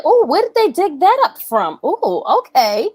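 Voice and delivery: high, swooping mock surprise; intrigued "ooh", stretched out at the end; surprised reaction. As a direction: act out a nosy, amused double take. Acts out own surprised reaction to footage.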